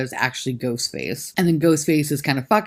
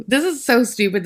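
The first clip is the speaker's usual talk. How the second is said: normal talking voice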